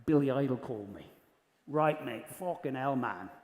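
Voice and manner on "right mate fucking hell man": a lightly voiced cockney rocker. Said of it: "what do you think of my [name]".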